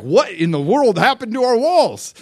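high, loud cry of mock alarm; mock panic. Preacher voicing panicked townspeople whose walls fell, in mock panic. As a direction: act out a panicked crowd, pitch swooping up. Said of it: "pa-, panicked".

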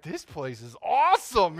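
driving through the parade, thrilled; overjoyed. Retold or imitated. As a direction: savour how wonderful it is. Joyful in dream parade.

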